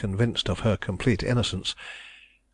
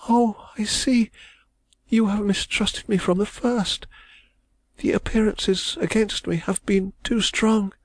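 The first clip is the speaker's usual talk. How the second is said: put-on distressed young woman; 12 semitones higher than usual. A narrator's reading. Young woman protesting her love, high and anguished. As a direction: cry it out as the wronged heroine. Heroine's voice, shamed.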